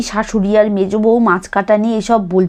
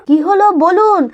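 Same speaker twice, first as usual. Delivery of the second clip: answering a call; 8 semitones higher than usual. Acted.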